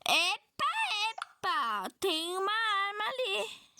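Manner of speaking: a sudden discovery, drawn out; surprised. Real, not acted. Spots a weapon in the game. (surpresa ao ver a arma)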